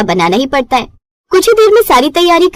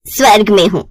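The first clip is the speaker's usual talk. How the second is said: lower, knowing mother's warning; mother warns daughter she'll come running back, lower voice; 4 semitones lower than usual. Acted. Mother on phone.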